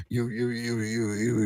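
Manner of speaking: usual speaking voice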